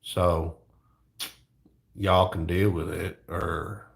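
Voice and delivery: laid-back Southern drawl; lazy cowboy drawl. Shown as a demonstration. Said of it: "that's my lazy cowboy voice".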